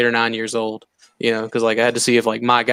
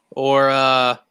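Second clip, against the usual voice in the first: normal talking voice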